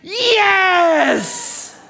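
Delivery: very high, loud drawn-out cry; hammed-up roar of approval; crowd's shouted cheer. Republicans reacting to Paul's provocation, long triumphant shout. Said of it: "all the Republicans went".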